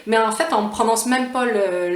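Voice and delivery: normal talking voice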